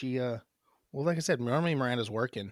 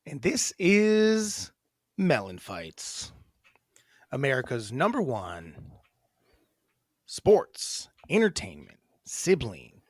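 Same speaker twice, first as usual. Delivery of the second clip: slow, drawn-out announcer intro; 4 dB louder, slower than usual. Acted. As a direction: announce the show title big. Announcing show name.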